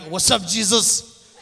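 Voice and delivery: slow, loud mock shout-out; imitated casual greeting. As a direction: imitate a friend's cheeky, drawn-out greeting. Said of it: "I'm gonna be like".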